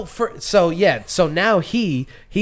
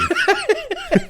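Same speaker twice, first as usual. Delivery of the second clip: squealing high laugh; sudden high-pitched laugh; high burst of laughter. Real, not acted. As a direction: let a shrill laugh escape. Laughing at cohost's joke.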